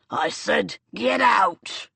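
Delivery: furious robber's bellow; angry shout. Acted. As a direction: roar the command at her. Robber orders her out.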